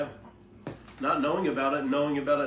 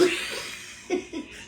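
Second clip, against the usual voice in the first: laugh; 11 semitones higher, 7 dB quieter than usual. Real, not acted. Laughs at violence joke.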